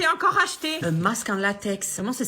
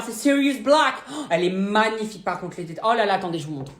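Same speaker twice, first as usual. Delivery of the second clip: normal talking voice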